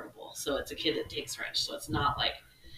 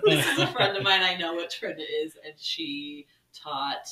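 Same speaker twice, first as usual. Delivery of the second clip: usual speaking voice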